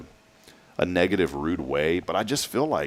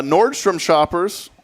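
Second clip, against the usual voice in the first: higher, louder and slow, like a promo call; drawn-out announcer call; 9 semitones higher, 4 dB louder, much slower than usual. Real, not acted. Host calling out to radio listeners, drawn-out announcer call. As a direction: stretch it out to grab listeners.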